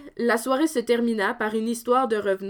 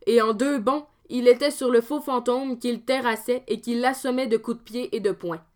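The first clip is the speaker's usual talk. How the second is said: usual speaking voice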